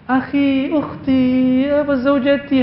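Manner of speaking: overly sweet forced couple talk; put-on sweetness. Preacher mocks couples' forced sweet talk, syrupy. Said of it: "Itu dibuat-buat namanya".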